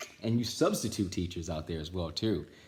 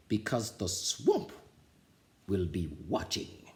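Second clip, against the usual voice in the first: slow, menacing scratchy voice; slow threat. Acted. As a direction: lean on the class. Swamp warning.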